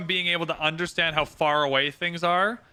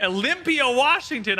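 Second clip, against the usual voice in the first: shout-out read of a viewer's location; hyped shout-out; 8 semitones higher than usual. Acted. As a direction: call it out like a hype announcer.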